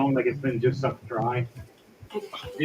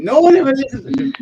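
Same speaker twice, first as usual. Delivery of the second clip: much higher, louder, fast indignant complaint; salty outburst; 10 semitones higher, 9 dB louder, faster than usual. Acted. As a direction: blurt it out offended and sulky. Salty NPC storming off.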